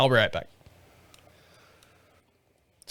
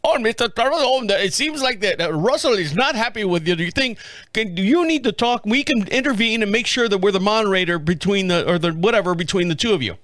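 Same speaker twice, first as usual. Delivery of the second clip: higher-pitched imitation Indian accent; 4 semitones higher than usual. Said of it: "well, actually, they're Indian".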